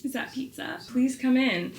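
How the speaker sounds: thrilled at the pizza; excited greeting to husband bringing pizza. Real, not acted. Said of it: "Eric brought me pizza".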